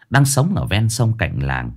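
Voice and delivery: ordinary delivery, nothing marked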